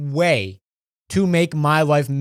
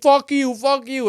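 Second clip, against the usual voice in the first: put-on drunk yell out the car window; 9 semitones higher than usual. Drunk friend yelling out the window at police. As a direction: shout it at the cops.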